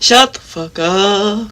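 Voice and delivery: furious shout at the screen; yelling. Real, not acted. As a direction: scream it out loud. Yelling at the video.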